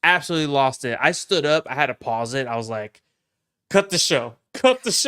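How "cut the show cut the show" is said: loud, high cry building higher on the repeat; worked-up fan calling a halt; retold outburst. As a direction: yell it overwhelmed, escalating. Retold outburst at shocking scene.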